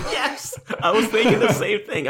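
high laughing agreement; real laughing. Real, not acted. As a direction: giggle through it.

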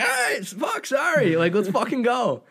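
high, loud and sloppy; high rowdy drunk shout; flustered drunk yell. Retold or imitated. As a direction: blurt it out, then apologise.

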